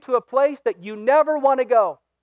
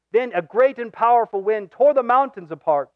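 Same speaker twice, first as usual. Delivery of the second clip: usual speaking voice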